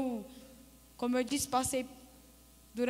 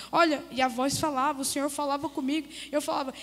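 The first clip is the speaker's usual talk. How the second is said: ordinary talk, nothing marked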